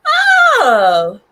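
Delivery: sudden high loud yelp of delight; excited yelp. Real, not acted. Streamer greeting chat with a loud squeal.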